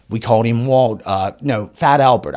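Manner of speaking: ordinary talk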